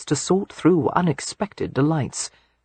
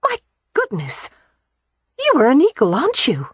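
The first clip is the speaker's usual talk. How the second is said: put-on higher, delighted young woman; 9 semitones higher than usual. A narrator's reading. Beatrix admires the Earl, higher and delighted. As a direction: marvel at him openly.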